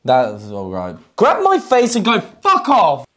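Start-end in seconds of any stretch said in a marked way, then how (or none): 1.2-3.1 high, emphatic mock dismissal; forceful rejection demo. Shown as a demonstration. Coach showing friend how to reject a man, mock forceful. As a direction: show how to tell someone off.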